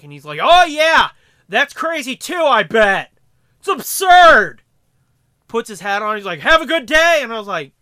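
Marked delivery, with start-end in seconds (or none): 0.4-4.6 high, loud overeager agreement; loud stranger agreeing on cue; loud eager stranger. Retold or imitated. Eavesdropper agreeing too loudly. 6.4-7.2 loud parting line. Retold or imitated. Stranger leaving.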